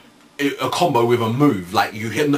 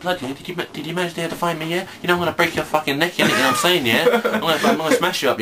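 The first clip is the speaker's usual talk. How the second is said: higher, taunting thug voice; psycho killer; 5 semitones higher, faster than usual. Pretend. Playing a psycho killer character, higher and taunting. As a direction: menace them like a psycho fighter. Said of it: "some sort of psycho killer".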